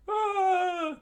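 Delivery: high falsetto scream; loud high-pitched cry; acted scream. Battle cry after warning.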